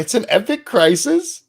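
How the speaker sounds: very high mock question; exaggerated rising mockery; incredulous mocking echo. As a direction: quote it back in high-pitched disbelief. Repeats her line in disbelief.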